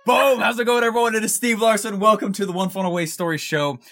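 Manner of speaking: high, punchy host opener; big excited podcast hello, easing off at the end; hyped show greeting. Real, not acted. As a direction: burst in with an energetic welcome. Host opening his show.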